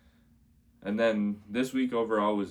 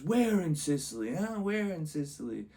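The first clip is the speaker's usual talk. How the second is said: put-on higher, pushy drunk heckler; heckler confronting; 9 semitones higher than usual. Drunk heckler challenging the comic, mock aggressive. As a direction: get in his face with a mock challenge.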